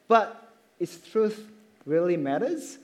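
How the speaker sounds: normal talking voice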